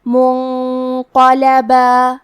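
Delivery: منقلبا very slow, nun hidden with a heavy nasal before the ق. Shown as a demonstration. Teacher demonstrates ikhfa in منقلبا. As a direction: let the nun in منقلبا hide into a full, heavy hum. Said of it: "غنہ کو بھی بھاری آواز میں پڑھیں گے".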